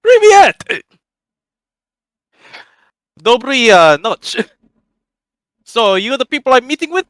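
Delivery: put-on booming, high Russian-accented character voice; stout foreign newcomer. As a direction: greet strangers in a high-pitched Slavic accent. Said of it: "he's just like".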